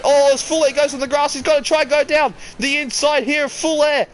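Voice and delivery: high, loud, urgent race call; rising thrill of the overtake; excited commentary. Real, not acted. Overtake chaos in live call.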